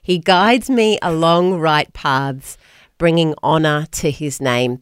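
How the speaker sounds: put-on low, slow Australian accent. Host reading a psalm for Accent Hour, Australian accent. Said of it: "but Australian".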